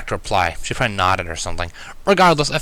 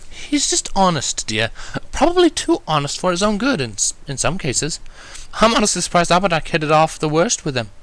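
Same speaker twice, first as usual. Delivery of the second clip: refined Rarity; 8 semitones higher than usual. A narrator's reading. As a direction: speak like a gracious lady. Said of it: "Rarity continued".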